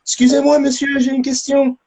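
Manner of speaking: high-pitched imitation French voice; French speaker. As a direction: put on a high, polite French voice. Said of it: "I hear in French".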